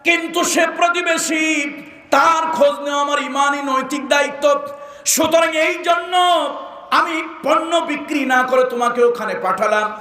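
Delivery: high declamation in short bursts; impassioned preaching. Acted. Shopkeeper explaining his duty to a neighbour, impassioned preaching.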